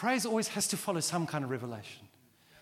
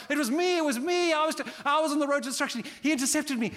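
excited testimony; 11 semitones higher, 7 dB louder, faster than usual. Retold or imitated. Voicing an excited convert.

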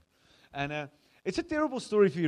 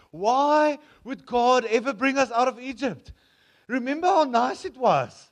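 loud, high, drawn-out wail; hammed-up crying complaint; whiny lament; 7 semitones higher, 11 dB louder than usual. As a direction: moan it like a spoiled crowd. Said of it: "the people cried".

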